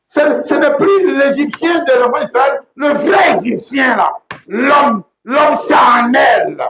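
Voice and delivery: impassioned sermon cry; preaching at full force. Acted. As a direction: proclaim it with a cry in the voice. Preacher crying out.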